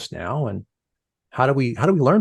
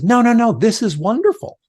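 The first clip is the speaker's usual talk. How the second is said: loud, high, delighted reassurance; the coach cheers the team at halftime; excited coach; 12 semitones higher, 5 dB louder than usual. Retold or imitated. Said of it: "And she says".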